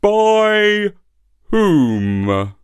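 coy, flattered donkey asking; simpering donkey. A narrator's reading. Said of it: "simpered the donkey".

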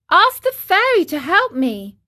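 high, loud puppet demand; urgent plea. Acted. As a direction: call urgently for help. Trapped marionette demands help.

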